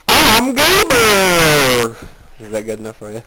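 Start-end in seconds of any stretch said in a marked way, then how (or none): 0.1-2.0 huge, high, drawn-out character shout. Acted. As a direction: belt out a cartoon catchphrase at full volume.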